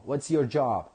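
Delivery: ordinary talk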